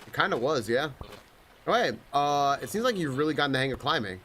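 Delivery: usual speaking voice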